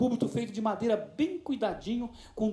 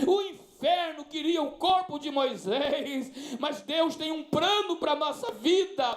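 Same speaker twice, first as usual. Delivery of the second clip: slow, rising, louder sermon build; fiery preaching; 4 semitones higher, 5 dB louder, much slower than usual. Real, not acted. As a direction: preach it harder and higher until you shout. Preaching climax to congregation.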